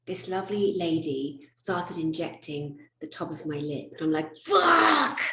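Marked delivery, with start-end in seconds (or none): 4.5-5.2 loud, high drawn-out curse; big hammed-up scream of pain; retold yell of pain. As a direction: yelp it out as if jabbed by a needle. Retells yelling in pain at injection.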